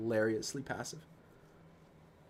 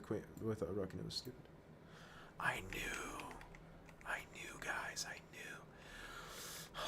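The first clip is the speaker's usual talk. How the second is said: rueful whispered to himself; 5 semitones lower, 14 dB quieter, slower than usual. Real, not acted. Streamer to chat after a missed win, whispering. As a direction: breathe it out in a whisper, deflated.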